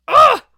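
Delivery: high, loud exasperated "Ugh"; loud frustrated groan. Real, not acted. Vlogger fed up with the drama, loud groaning "Ugh".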